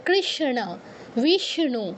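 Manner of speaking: কৃষ্ণ as krish-na, বিষ্ণু as vish-nu; sh-n conjunct. Shown as a demonstration. Teacher demonstrates to students, ষ্ণ spoken as sh-n. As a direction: sound sh then n in কৃষ্ণ and বিষ্ণু. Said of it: "উচ্চারণটা কি হবে".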